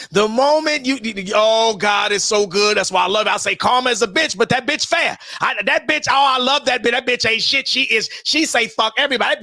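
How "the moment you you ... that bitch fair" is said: usual speaking voice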